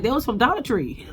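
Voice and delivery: high-pitched, amused retold jab; retold amusement. Castmate mocking the flowers to her rival, amused retelling. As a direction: imitate her cracking up at her own dig. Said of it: "Letitia starts laughing".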